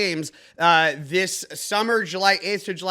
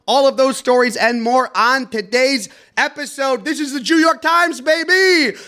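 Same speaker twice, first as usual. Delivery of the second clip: high, loud announcer-style intro; show intro hype; 6 semitones higher, 6 dB louder than usual. Acted. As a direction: sell the episode like a hyped host.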